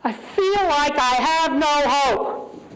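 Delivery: admitting despair, slow and pained. Real, not acted. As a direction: say it like the hope is gone. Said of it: "Here are my human feelings right now".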